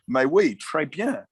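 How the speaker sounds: slow, hammed-up schoolboy French; eager, badly faked French accent; attempted French. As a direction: show off a little French to impress. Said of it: "trying to speak to him in, in French".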